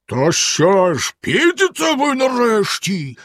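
slow, high furious call from below; furious yell. A narrator's reading. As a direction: shout up in rage. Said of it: "розлючено загорлав знизу". (розлючено загорлав)

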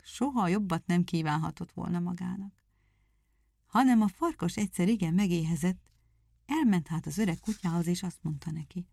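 ordinary delivery, nothing marked

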